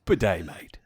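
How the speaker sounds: put-on Aussie accent; mock Australian ad voice. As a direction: ham it up like an Aussie salesman. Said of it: "my Australian accent sounds like".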